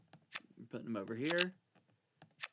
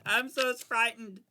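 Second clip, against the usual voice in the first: mock fright; 9 semitones higher, 12 dB louder, slower than usual. Mock fear over a risky move.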